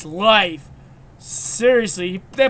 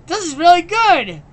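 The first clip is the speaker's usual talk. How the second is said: higher, louder goofy character voice; Grim's voice; 5 semitones higher, 3 dB louder than usual. Retold or imitated. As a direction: play the delighted dimwit. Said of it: "Grim's like".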